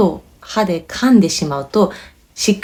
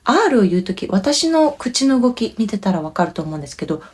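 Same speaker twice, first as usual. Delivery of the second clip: usual speaking voice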